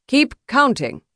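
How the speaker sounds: high, loud order; calling out. A narrator's reading. Duelist ordering the count to go on, calling out. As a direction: call it out across the field. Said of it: "Harrison called".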